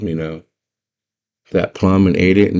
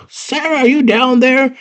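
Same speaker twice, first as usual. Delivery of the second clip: very high-pitched character calling down; hammed-up high searching call; Hoggle; 3 dB louder than usual. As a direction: call down high to someone lost below. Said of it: "Oh, she hears Hoggle".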